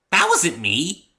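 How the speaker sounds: high, loud and slow denial; Pinky voice. A narrator's reading. Pinky denies throwing the apple, high and innocent. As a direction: insist innocently.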